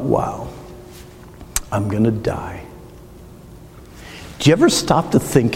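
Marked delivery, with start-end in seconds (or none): 0.0-2.6 hushed realization. Retold or imitated. Realizing death on the gurney.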